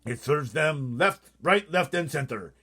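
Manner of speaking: slow, loud, high and gleeful. A narrator's reading. BFG laughing at the cursing giants, gleeful. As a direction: relish the joke. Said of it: "The B F G laughed".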